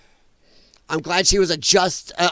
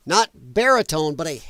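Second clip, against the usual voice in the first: ordinary delivery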